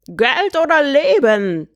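high, drawn-out screech; screeched threat. A narrator's reading. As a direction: screech it out slowly like a bird robber. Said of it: "schrie eine Stimme".

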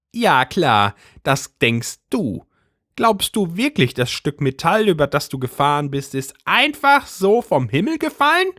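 high agitated housewife voice; Brigitte's voice. A narrator's reading. Brigitte conspiratorial.

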